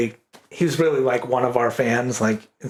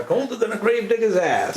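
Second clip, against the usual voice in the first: mock Maine saying; 7 semitones higher than usual. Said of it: "And it'd be like".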